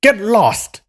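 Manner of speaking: high, loud, put-on jeering voice; rude shout. Inn boy chasing off the beggar, rude shout. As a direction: yell at him to clear off.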